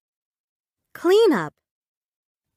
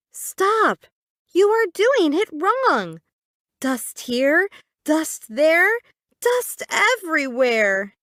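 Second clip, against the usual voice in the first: a sister scolding her brother for making a mess, high bossy voice; 5 semitones higher than usual. Acted. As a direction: tell him off like a big sister. Zoe scolds Zach's cleaning.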